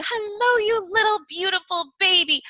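put-on high, sing-song coo to a baby; baby talk. Cooing over her baby son in a high voice. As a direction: coo it like talking to a newborn. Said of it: "when you say".

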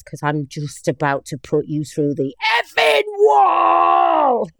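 hammed-up threat from a riled coworker; aggressive threat. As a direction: coworker snaps back at the team leader, mock aggressive. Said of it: "he starts to get a bit aggressive".

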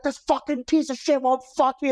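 high, hammed-up angry complaint; mock furious woman. Drunk woman complaining to roommate, high mock rage. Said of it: "She's like".

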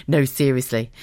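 usual speaking voice

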